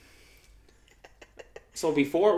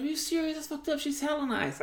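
high mock-indignant girl voice; 13 semitones higher than usual. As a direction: play the offended friend, pitched up. Girl indignantly defends her friend.

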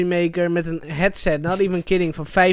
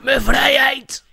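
aggressive raspy man; 8 semitones higher, slower than usual. Retold or imitated. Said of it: "sprak alsof hij ... sigaretten rookt".

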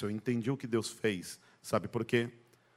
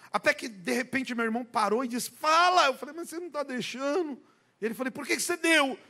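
very high, strained storytelling voice; excited retelling; 13 semitones higher, 4 dB louder than usual. Retold or imitated. Preacher retells his brother's questioning in a very high voice. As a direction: tell it high and breathless as the scene peaks.

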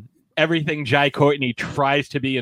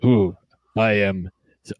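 low, stilted monotone overlord; personality-free villain; 7 semitones lower than usual. Retold or imitated. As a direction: drone the order out with no personality. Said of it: "Everybody else is just like".

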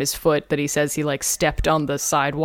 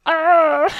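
high, louder mock cry of pain; hammed-up whiny groan; pathetic wail; 12 semitones higher, 5 dB louder than usual. Suspect moaning over his wrist, pathetic mock wail. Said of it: "really pathetic about it".

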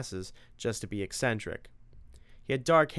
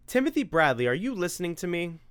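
higher, louder nagging mom; a mother scolding her son for not listening; 8 semitones higher, 4 dB louder than usual. Acted. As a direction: snap him out of his daydream.

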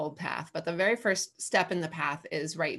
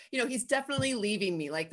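usual speaking voice

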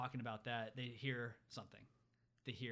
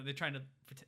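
ordinary delivery

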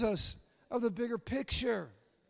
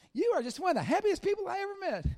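much higher, louder gushing praise; gushing fan; 10 semitones higher, 4 dB louder, faster than usual. Retold or imitated. Stranger at a basketball game gushing, higher excited praise. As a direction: rave like an admiring stranger. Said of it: "going to a basketball game".

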